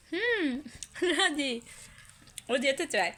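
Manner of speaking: light up at her yes; delighted. Real, not acted. Excited at child's nod.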